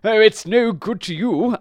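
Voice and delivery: a high, loud horse's rebuke; scolding horse. Acted.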